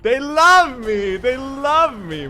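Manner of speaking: loud, high, slow victory shout; triumphant cheer. Real, not acted. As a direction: crow it out like you won. Triumphant cheer after praise.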